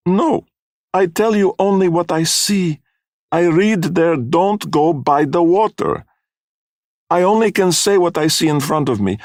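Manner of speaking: high-pitched pleading in broken English; pleading foreign fortune-teller. A narrator's reading. Missus Grummick defending her reading to angry parents, pleading. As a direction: plead your innocence in a high foreign woman's voice.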